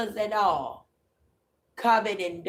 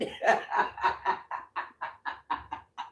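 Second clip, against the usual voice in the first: wheezy chuckle that fades out; trailing laugh. Real, not acted. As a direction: laugh it off until it dies away. Laughs at own rant.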